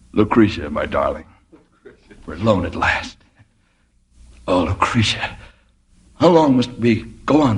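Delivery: romantic. Acted. Romantic werewolf to secretary.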